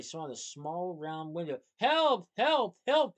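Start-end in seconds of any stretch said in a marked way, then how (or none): 1.8-3.2 high, loud desperate screams; screaming for help. Acted. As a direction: scream for help, trapped and drowning. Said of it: "they screamed over and over".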